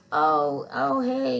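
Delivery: loud, lightly twangy Southern greeting; Southern twang. Retold or imitated. Neighbor greeting her at the door, light Southern twang. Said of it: "Southern twang kind of thing".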